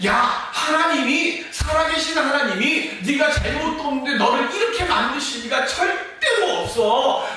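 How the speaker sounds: loud, high scolding voice of Job's friends; accusing friends. Acted. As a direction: press him hard to confess, building to a shout on 토설해. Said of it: "친구들은 뭐라고 얘기합니까".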